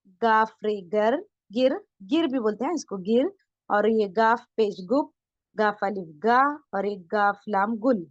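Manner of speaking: slow, letter by letter; slow reading for the class. Shown as a demonstration. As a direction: read it out slowly for students to follow. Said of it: "पढ़ेंगे भी सभी मेरे साथ". (धीरे-धीरे, हर्फ़ जोड़कर पढ़ाना)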